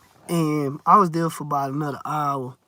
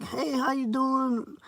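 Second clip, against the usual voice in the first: mock polite greeting; 7 semitones higher, 5 dB quieter, slower than usual.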